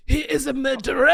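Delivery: loud, high, shouted accusation; imitating a commentator's outraged shout; Ash Holmes. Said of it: "as Ash Holmes would say it".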